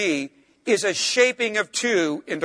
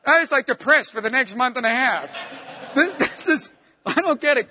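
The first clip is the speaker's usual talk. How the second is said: laughing; 5 semitones higher than usual. Real, not acted. Laughing at his own story.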